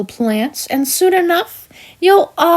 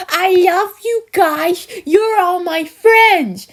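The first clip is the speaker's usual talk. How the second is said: higher-pitched mock gushing; mocking imitation; 5 semitones higher than usual. Villain mimics the bear's love for his friends, mocking. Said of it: "just a red bear who goes all".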